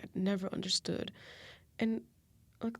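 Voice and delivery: normal talking voice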